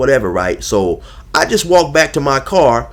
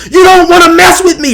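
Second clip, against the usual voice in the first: much higher, louder shouted threat; mock screaming; 9 dB louder than usual. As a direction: yell the threat hysterically. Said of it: "Just scre".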